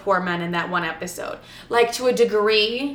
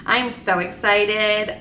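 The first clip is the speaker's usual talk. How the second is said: ordinary talk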